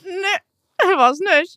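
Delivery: squeaky laughing reply. Real, not acted. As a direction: laugh it out high. (lachend, hohe Stimme)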